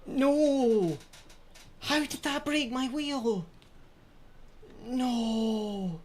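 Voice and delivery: real despairing shouts, voice cracking high; anguished outcry. Real, not acted. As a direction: cry out at the broken wheel. Wheel breaks mid-race, despairing outburst.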